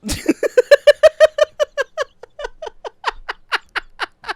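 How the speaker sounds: high, loud breathless laughter; helpless laughing fit. Real, not acted.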